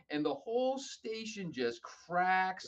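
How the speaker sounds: usual speaking voice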